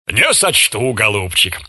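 ordinary delivery

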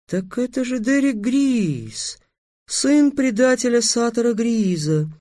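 put-on high, slow girl's voice; dawning realization. A narrator's reading. Princess finally recognizing the traitor's son, slow high realization. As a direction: realize it gradually, drawn out and high. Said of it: "сообразила наконец Татьяна".

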